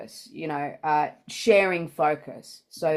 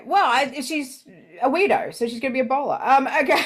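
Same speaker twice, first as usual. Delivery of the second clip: lightly raised, gossipy voice; high character voice; 7 semitones higher than usual. Acted. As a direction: play a nosy local, pitched up.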